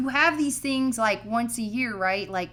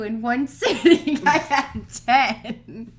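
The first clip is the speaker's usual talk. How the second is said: laughing; 5 dB louder, much slower than usual. Real, not acted. Laughing boast.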